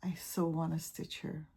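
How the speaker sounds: soft whispered longing. Real, not acted. To the camera about a pattern, whispered. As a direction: breathe it out dreamily.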